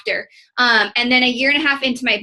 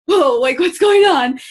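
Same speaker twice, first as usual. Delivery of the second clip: higher, louder startled "whoa, what's going on"; startled husband; 4 semitones higher, 6 dB louder, slower than usual. Retold or imitated. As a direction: voice the husband caught off guard by her tears. Husband startled by her crying.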